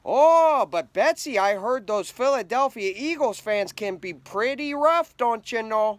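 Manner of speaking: put-on sweet, worried Minnesota accent; mock Minnesota fan warning about Eagles fans; Minnesota accent, second fan. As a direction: play a fretful Minnesotan friend. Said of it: "couldn't wait to tell all your friends".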